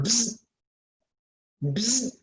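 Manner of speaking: mock beeper noise; lane assist buzz. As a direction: buzz like a car's lane alarm. Imitating car lane assist.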